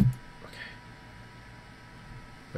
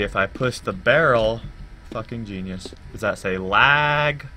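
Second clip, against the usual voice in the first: normal talking voice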